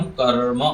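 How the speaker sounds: कर्म as "karr-ma", r before m; correct "kar-ma". Shown as a demonstration. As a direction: sound the r of कर्म first, then "ma". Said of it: "तो यहाँ पर क्या बोलेंगे हम".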